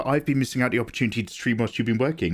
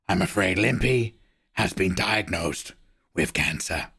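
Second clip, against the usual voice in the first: lightly voiced Pacino, slow with lower trailing ends; a hint of the gruff old actor telling a sad tale; 5 semitones lower than usual. Streamer telling the Limpy story in a light Pacino voice. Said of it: "I've got Al Pacino".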